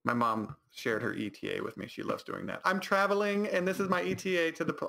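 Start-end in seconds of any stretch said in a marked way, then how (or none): 2.6-4.9 high-pitched, chirpy mimicry of mom; mocking mom voice. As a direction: imitate a mother proudly sharing her plans. Mocking mom sharing her ETA.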